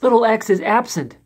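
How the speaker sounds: high excited cry; exclaiming. A narrator's reading. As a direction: exclaim it in alarm. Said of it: "little A exclaimed".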